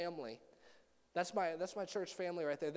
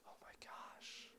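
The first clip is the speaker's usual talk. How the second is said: whispered in shock, much softer; stunned whisper; 17 dB quieter, slower than usual. Retold or imitated. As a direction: whisper to yourself, overwhelmed by the noise. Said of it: "so I get really quiet".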